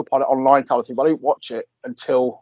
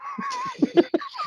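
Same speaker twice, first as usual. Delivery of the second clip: shrieking laugh, much higher; high squealing laugh; 10 dB quieter than usual. Real, not acted. Host squealing with laughter at an awful pun.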